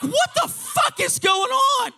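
very high, loud shout; astonished yell. Acted. Young narrator amid the mob, astonished high shout. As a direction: yell in bewildered disbelief.

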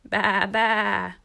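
loud storybook sheep baa; bleating. A narrator's reading. Woolly duck helps call Webster's mother, loud bleating. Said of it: "baaed the big woolly duck".

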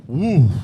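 loud, high "woo"; imitated cold shiver whoop. Bundled up in bibs against cold, mock whoop. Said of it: "you're like".

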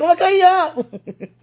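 high, loud and laughing, drawn out slowly; laughing high-pitched excitement; giddy squeal. Retold or imitated. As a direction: replay the happy squeal. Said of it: "I was like".

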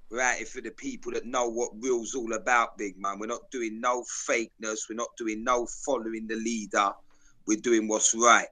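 usual speaking voice

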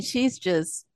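usual speaking voice

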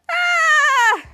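loud high-pitched scream; long falling shriek, hammed up; mock terrified scream. Mika sees the needles, mock terrified scream. All the kids panic at needles.

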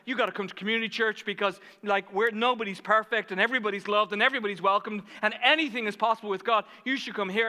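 fast, loud urging; fired up. Retold or imitated. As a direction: re-enact an excited, breathless invitation. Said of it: "I'm getting all fired up".

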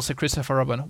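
ordinary delivery, nothing marked

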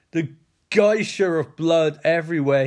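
normal talking voice

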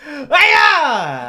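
martial arts shout. Acted. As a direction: yell the trick like a kung fu move. Magic trick shout.

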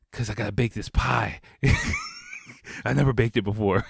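chuckling at his own blunder; laughing through it. Retold or imitated. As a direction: tell it while cracking up. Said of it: "I was like".

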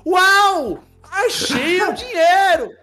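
high, loud, slow overacted child shout; fake surprised kid. Boy pretending to his mom he found money, high overacted shout. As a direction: ham up the lucky discovery.

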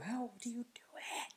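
hushed, voiceless disbelief; incredulous whisper. Acted. Mock bewilderment at others' pretending.

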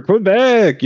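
high, drawn-out call to the crowd; mock hype shout. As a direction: hype the crowd with the city name. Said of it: "He might have been like".

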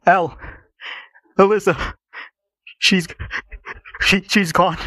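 high, broken stammer with pauses; grieving father, high and faltering; crying. A narrator's reading. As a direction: choke it out in halting bursts. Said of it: "the man who was crying".

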